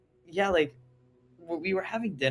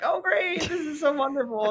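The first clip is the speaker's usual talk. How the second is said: very high, loud fake enthusiasm; overdone sarcastic squeal; sarcastic delight; 4 dB louder than usual. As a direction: gush in a high mock-cheerful voice. Sarcastic retold reaction to family fight.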